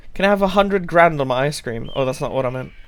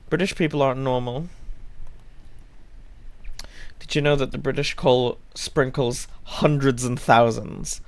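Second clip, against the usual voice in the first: ordinary talk, nothing marked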